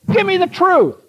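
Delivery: high-pitched mock royal demand; insistent demand. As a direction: act out an impatient king insisting. Acted king insisting on truth.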